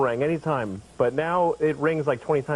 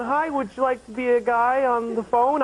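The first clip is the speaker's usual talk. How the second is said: high, put-on caller voice; raised-pitch mock sales pitch; telemarketer; 7 semitones higher than usual. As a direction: offer it like a phone rep. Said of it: "It's like calling me and going".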